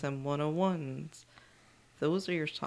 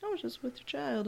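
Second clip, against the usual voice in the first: light high-pitched imitation; a raised, airy retelling; high quoting voice; 11 semitones higher, faster than usual. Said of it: "she was like".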